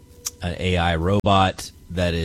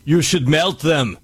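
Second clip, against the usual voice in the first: higher, slow, louder put-on action-star voice; Arnold Schwarzenegger; 9 semitones higher, 4 dB louder, slower than usual. Co-host reading out Arnold Schwarzenegger's reply, exaggerated impression. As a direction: imitate a movie Terminator giving an order. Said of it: "said it in that voice".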